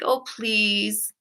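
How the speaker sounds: soft, mock-pouty plea; pouty pleading. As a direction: plead a little with an imitated pout. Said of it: "kinda like that pouty face".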